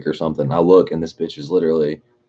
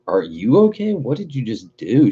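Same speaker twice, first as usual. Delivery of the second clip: mock incredulous echo; 6 semitones higher than usual.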